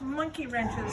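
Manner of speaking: usual speaking voice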